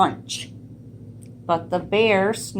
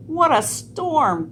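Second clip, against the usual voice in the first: higher, twittery little bird voice; twittering wren; 8 semitones higher than usual. A narrator's reading. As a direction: chirp it like a small wren. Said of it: "twitters friend wren".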